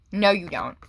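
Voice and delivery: ordinary talk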